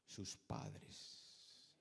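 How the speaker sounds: low unvoiced whisper; hushed. Preacher whispering about Daniel's parents. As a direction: whisper it for weight. Sermon dramatic hush.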